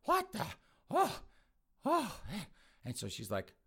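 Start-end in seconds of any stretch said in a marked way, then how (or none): 0.0-2.5 high, weak groaning fragments; dazed, coming to. Acted. Mother coming round after being tased, dazed. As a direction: groan haltingly as if waking from a shock. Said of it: "Mama comes to her senses".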